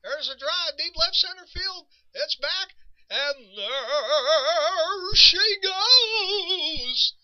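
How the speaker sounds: high, rising call with a long, slow stretched finish; drawn-out hayseed radio call; Monty Moore home run call. Retold or imitated. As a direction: imitate an old-time play-by-play man calling a homer. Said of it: "His home run call was usually this way".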